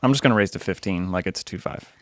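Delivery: ordinary talk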